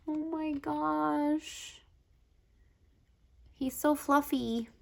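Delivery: high-pitched, gushing coo; melting, pitched right up; adoring coo. Real, not acted. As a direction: coo over something unbearably cute. Melting over fluffy bear picture.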